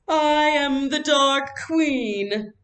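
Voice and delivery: slow, high and loud grand proclamation; grand theatrical queen voice; dramatic queen. Acted. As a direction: intone it like a stage queen commanding her court. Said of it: "she intoned dramatically".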